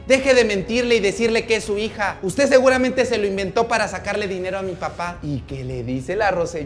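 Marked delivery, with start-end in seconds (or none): 0.1-5.2 loud, high acted tirade; possessive angry rant. Paola claims Esteban as only her father, possessive angry rant. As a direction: lay claim to him in a shouted accusation.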